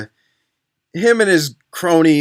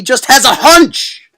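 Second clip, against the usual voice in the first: incredulous outburst; 8 semitones higher, 8 dB louder, slower than usual. Real, not acted. Ranting at plot.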